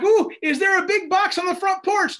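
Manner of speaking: high, loud giddy squeal; loud eager squeal at the door; giddy excitement. Retold or imitated. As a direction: squeal like a kid expecting a present. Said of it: "I'm like".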